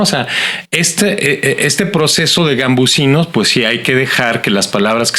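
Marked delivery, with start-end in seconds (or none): none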